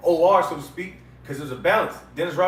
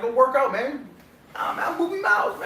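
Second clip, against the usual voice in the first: higher-pitched retold urging and brush-off; retold back-and-forth; 7 semitones higher than usual. Everybody urging Jake to work out, retold dismissively. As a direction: mimic a higher-voiced brush-off. Acting out others' exchange.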